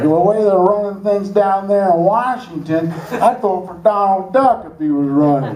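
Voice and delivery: high folksy put-on voice of a news guy. Comic imitating a voter heard on the news, high and folksy. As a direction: mimic the fed-up voter way up high. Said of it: "He's like".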